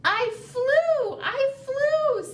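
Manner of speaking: high thrilled cry; young bird squealing with pride; proud little bird. Acted. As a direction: shout it like a bird that just flew. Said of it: "said Mel".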